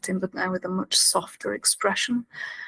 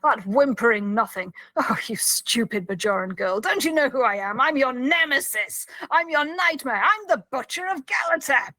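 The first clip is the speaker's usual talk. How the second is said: imitated villain's boast, building higher; contemptuous retold tirade, climbing to a climax; full-on villain; 3 semitones higher than usual. Prisoner selling his performance to Kira, villainous. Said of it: "he's in full-on villain mode here".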